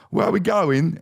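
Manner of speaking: put-on high-pitched old London cabbie; British accent. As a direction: imitate an old British taxi driver. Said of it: "it's some old guy".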